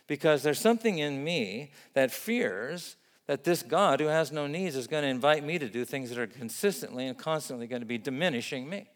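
usual speaking voice

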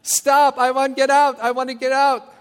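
loud, high, desperate plea; frantic yelling. Retold or imitated. Preacher acting out panic on a ride, shouting to stop. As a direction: scream to be let off.

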